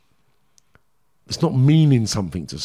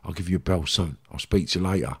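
lower, softer hurried excuse; dismissive brush-off; 5 semitones lower, 5 dB quieter than usual. Retold or imitated. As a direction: fob him off politely and leave. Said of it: "He went".

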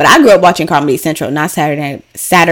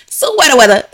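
higher-pitched playful skit mimicry; skit-style catchphrase; 6 semitones higher than usual. Shown as a demonstration. Proving to y'all how she says it, mock skit voice. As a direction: exaggerate it like the comedy skit. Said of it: "That is exactly how I say it".

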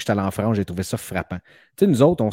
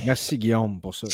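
normal talking voice

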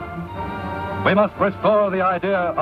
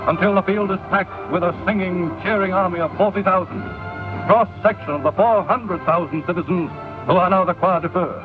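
vintage 1930s newsreel narration. Real, not acted. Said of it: "This is a newsreel from the early nineteen thirties".

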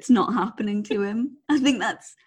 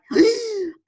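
sharp, high gasp; horrified gasp; 11 semitones higher than usual. Retold or imitated. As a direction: gasp in mock horror. Retells her horrified reaction to yard.

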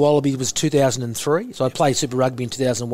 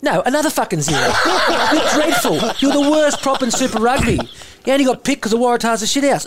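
higher, louder mock tirade through laughter; laughing impression of a harsh coach tearing into a player; imitating Eddie Jones berating; 11 semitones higher, 4 dB louder than usual. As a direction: bark the put-down like a brutal coach, half laughing. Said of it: "I'll go in and out of his voice".

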